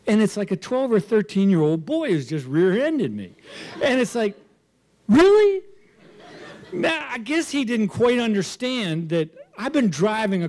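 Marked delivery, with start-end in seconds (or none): 5.1-5.6 sharp high incredulous really. Retold or imitated. Man rear-ended by a boy, high incredulous. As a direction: disbelief at being rear-ended. Disbelief at kid ramming him.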